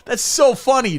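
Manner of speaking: high, loud fake delight; mock amusement. Comic mocking an unfunny joke, gushing mock amusement. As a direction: gush over it far too hard. Mocks the meme joke's reception.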